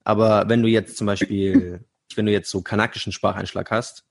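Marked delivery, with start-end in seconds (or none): none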